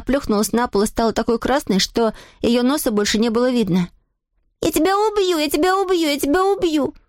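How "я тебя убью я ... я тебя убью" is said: put-on high-pitched little girl's threat; crying out in rage. A narrator's reading. Adele, just hit, threatens her brother, crying out high. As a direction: repeat the threat in a high, furious child's cry. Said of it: "закричала она".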